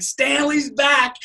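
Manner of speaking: high, drawn-out imitated kids' shout; excited kids' cheer. Kids greeting the cat home, excited cheer. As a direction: cheer it out like delighted children. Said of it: "my kids were like".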